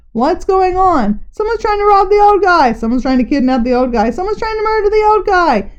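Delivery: high, excited crowd cries; voices ringing out. A narrator's reading. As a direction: panicky bystanders shouting over each other. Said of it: "Voices rang out".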